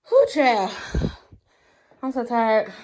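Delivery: ordinary talk, nothing marked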